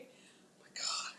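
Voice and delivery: soft unvoiced whisper. Real, not acted. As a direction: whisper it in embarrassment. Embarrassed aside.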